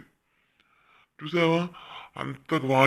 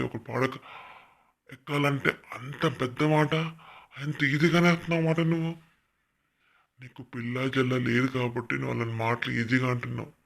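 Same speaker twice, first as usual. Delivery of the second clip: ordinary delivery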